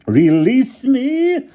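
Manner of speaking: put-on very slow, high, loud old woman's voice; insistent. A narrator's reading. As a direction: drag out the demand. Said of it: "insisted Miss Scrimmage".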